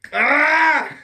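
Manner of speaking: a sharp frustrated yelp breaking into a laugh; frustrated cry then laugh. Real, not acted. Rant vlogger fumbles a word, yelps and laughs.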